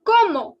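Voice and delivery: comment said "como", the final NT silent; French comment. Shown as a demonstration. Teacher correcting comment to "como". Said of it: "the word is not comment, it is".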